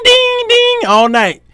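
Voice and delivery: high, slow ding ding chime; imitated ding. Preacher mimics his inbox, high slow dinging. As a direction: sing it like a phone alert.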